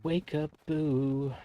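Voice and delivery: high, slow, drawn-out coaxing; high slow singsong to the boos; coaxing ghost call. Pretend. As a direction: lure them out like a spooky playmate.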